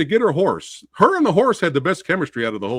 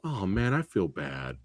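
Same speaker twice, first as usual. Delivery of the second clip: lower, softer mock pity; a touch of sad sympathy for the movie, quieter; wife's pity; 10 semitones lower, 10 dB quieter, slower than usual. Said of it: "my wife said".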